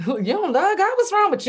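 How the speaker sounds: loud, high mock disbelief breaking into laughter; condescending. Mocking her old self judging others' faith, loudly condescending. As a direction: scold them from a high horse, half laughing. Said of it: "I was kind of condescending".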